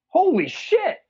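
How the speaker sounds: high, loud burst of dawning shock; big high-pitched astonishment, hammed-up; mock stunned realization. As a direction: act out the penny dropping, voice leaping up. Voicing Alex realising the truth.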